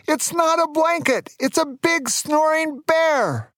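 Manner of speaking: very high falsetto shriek without real volume; mock scream. A narrator's reading. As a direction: squeal it in comic panic. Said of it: "I screamed".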